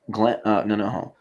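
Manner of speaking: ordinary talk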